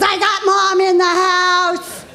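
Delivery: high, drawn-out sung call; hype announcement. Retold or imitated. Daughter announcing her mom to the crowd, high and sung. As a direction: belt it like an MC. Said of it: "She goes".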